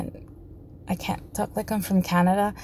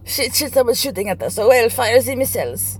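a touch louder, hammed-up panic; mock panicked China; 3 semitones higher, 6 dB louder than usual. A lightly voiced, mock-panicked China repeating the video line. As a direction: play the alarmed nation. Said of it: "China's like".

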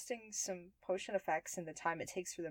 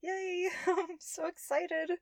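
excited; 11 semitones higher, slower than usual. Acted. Giddy before drinking the potion.

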